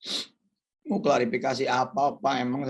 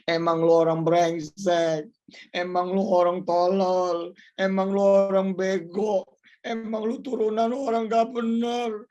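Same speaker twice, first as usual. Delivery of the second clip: rising, ever louder jeering insults; mocking taunts; 8 semitones higher, 3 dB louder than usual. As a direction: taunt him like scornful friends. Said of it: "akan ngomong seperti ini".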